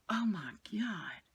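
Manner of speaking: breathless slow whisper under the breath; whispered aside. Real, not acted. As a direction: drop to a hushed, slow whisper.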